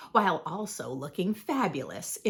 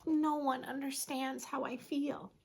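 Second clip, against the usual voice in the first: higher, softer, self-pitying murmur; small, misunderstood vampire voice; lonely inner thought; 4 semitones higher, 6 dB quieter than usual. A narrator's reading. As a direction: think it aloud, softly and sorrowfully. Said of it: "he thought".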